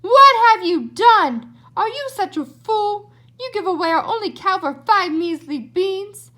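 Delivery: angry mother yelling at her son over the beans. Acted. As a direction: scream at the foolish son. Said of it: "his mother started to yell".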